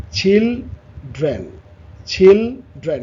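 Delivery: "Children" as চিল, pause, drain; split in two. Shown as a demonstration. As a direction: say "children" in two separate beats, slowly. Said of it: "এখানে দুটো". ("Children" দুই ভাগে থেমে থেমে)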